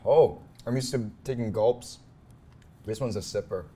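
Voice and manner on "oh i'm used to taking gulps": ordinary talk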